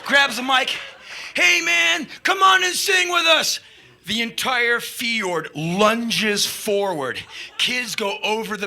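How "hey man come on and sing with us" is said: high, loud rallying call; imitated frontman shout. [name] grabs the mic to invite kids, rallying shout. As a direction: call out to a whole crowd.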